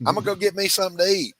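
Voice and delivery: quick, high mock Southern slang; Georgia slang. Shown as a demonstration. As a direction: rattle off "Imma" like a local. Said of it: "Instead of, we would say".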